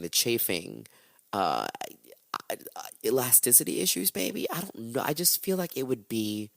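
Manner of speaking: usual speaking voice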